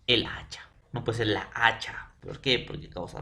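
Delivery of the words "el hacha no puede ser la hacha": whispered. Real, not acted. Teacher contrasts right and wrong.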